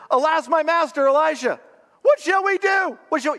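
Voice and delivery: very high, loud cry of panic; panicked servant. Acted. Servant runs to his master about the army, panicked. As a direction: cry out in acted alarm, pitch shooting up. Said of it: "can you feel the panic in this guy's voice?".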